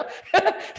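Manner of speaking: high, loud laugh; a short real laugh; burst of laughter. Real, not acted. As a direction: laugh at his own acted scene. Laughing at own demo.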